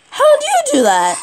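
high-pitched baffled cry; exasperated amazement. Real, not acted. Player baffled by an opponent's trick, exasperated. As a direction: demand it, voice leaping up.

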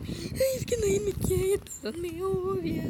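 high whiny fake sad voice; hammed-up pity-me whine; mock sad. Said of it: "bien triste".

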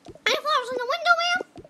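squeaky fast high voice. Pretend. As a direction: chirp it up high and quick.

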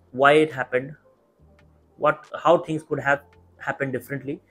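ordinary delivery

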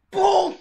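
imitated car boom. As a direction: burst out with a car's bang. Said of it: "you can hear the car doing like this".

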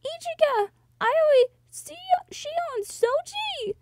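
very high calling out of names; acted yell. Lost heroine calling her friends, high acted yell. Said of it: "I yelled as loud as I could".

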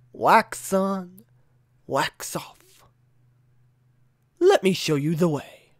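soft, slow wise-master voice; calm sensei. Retold or imitated. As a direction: guide gently like a martial arts teacher. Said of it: "an iconic scene from The Karate Kid".

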